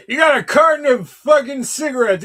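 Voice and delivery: high, loud excited answer. Real, not acted. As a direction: blurt it out, thrilled. Excited trivia answer, much higher.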